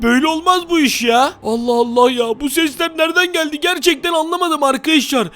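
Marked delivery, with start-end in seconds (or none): none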